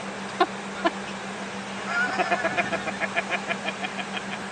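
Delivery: breathless laugh. Real, not acted. Laughing at his own word.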